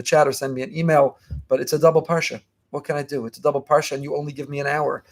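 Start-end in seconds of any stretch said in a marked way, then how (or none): none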